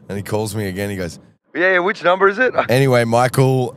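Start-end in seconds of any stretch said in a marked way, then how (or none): 1.6-2.5 much higher, louder caricature; chirpy confused tradie; Michael's voice. Retold or imitated. Impersonating Michael.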